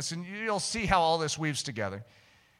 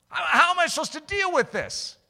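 tag exasperated protest; 12 semitones higher, 7 dB louder than usual. Retold or imitated. Protesting to God about the task.

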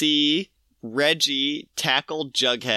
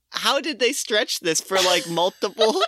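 incredulous; 9 semitones higher than usual. Retold or imitated. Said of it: "And I'm like".

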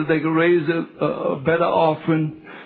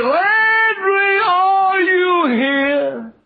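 loud, high, drawn-out call; high calling shout; 12 semitones higher, 4 dB louder, much slower than usual. Retold or imitated. Preacher calling out for Landry a second time. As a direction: stretch out the question slowly. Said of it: "The second time he said".

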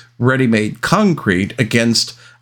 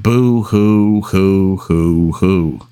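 fake crying; 3 semitones lower, much slower than usual. Mocking company's loss with sobs.

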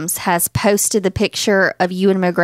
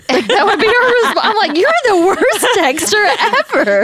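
much higher and louder, laughing; laughing disbelief; 12 semitones higher, 4 dB louder than usual. Real, not acted. Daughter laughing about her mom's terrible texting. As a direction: crack up in exasperation.

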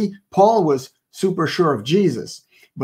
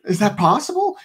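much higher, squeaky mock shock; stunned disbelief. Host acting out shock at seeing the frog-like guy, high incredulous squeak. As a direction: gasp out the disbelief. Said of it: "and I was like".

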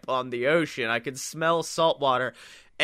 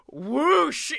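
higher whooshing sound; whoosh sound effect; 11 semitones higher, 3 dB louder than usual. Retold or imitated. Narrator makes the sea god's rushing whoosh. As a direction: sweep the word like rushing water.

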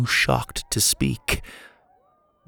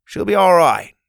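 louder, firm reassurance; reassuring; 8 semitones higher, 7 dB louder than usual. Acted. Doctor Rex reassures Pa about the injured Joe. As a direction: assure Pa she'll pull through.

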